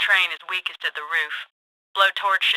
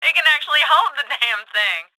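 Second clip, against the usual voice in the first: amazed outburst; 6 semitones higher than usual. Acted. Accomplice seeing the crane hold the load, amazed outburst. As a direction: burst out in delighted disbelief.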